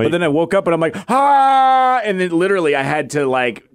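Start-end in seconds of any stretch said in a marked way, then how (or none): 1.1-2.0 higher mock cry of pain; sudden yell, acting out waking in pain; re-enacted pained yell.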